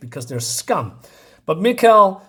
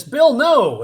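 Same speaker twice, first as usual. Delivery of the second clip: higher, louder cry to a friend; retold cry of protest; 10 semitones higher, 7 dB louder than usual. Comic hero tries to stop Bill, loud retold cry. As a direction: call it out in mock alarm.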